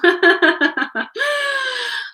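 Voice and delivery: high, loud burst of laughter; shrieking laugh. Real, not acted. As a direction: burst out in a shrill laughing shout. Laugh after rant.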